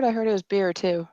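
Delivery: ordinary talk, nothing marked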